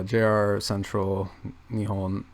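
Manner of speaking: usual speaking voice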